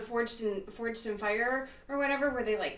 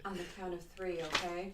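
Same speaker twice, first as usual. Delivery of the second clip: sultry voice; 2 semitones lower, 6 dB quieter than usual. Acted. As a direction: purr it like a seductive heroine. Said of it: "You're enjoying that, aren't you?".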